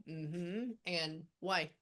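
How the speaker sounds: normal talking voice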